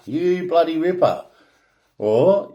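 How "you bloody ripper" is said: Australian accent, lifted and punchy on bloody; a mate cheering in broad Australian; Aussie exclamation. Shown as a demonstration. To viewers, an Aussie cheering you bloody ripper, broad accent. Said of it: "Aussie slang".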